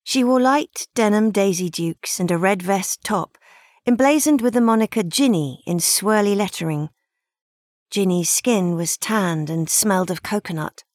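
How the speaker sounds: usual speaking voice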